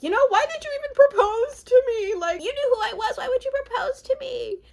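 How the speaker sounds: imitating a fiancée who feels rejected; triggered and hurt. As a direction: play her rejected and hurt, high and pushed. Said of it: "triggered 'cause she feels rejected and hurt".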